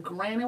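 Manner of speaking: "granted" said GRAN-it, no middle T; granted as granite. Retold or imitated. Contestant rejecting the wrong version, "granted" as granite. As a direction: slowly mimic "granted" as "granite". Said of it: "who says it that way?".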